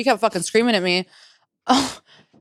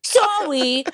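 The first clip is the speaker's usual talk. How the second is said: high-pitched teasing name call; mocking; 6 semitones higher than usual. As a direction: mimic a friend drawing out the name to tease. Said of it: "Mocking me".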